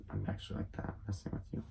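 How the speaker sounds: confiding whisper. Real, not acted. Telling the listener a secret, whispering. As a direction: lean in and whisper it. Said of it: "This is secret just between".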